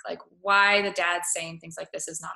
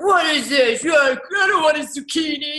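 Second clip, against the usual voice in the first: higher, louder hammed-up husband griping; mock cranky husband; 6 semitones higher, 9 dB louder than usual. Imitating the husband rejecting his wife's zucchini dinner, loud mock rant. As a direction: bark it like an ungrateful husband at dinner. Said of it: "And he's like".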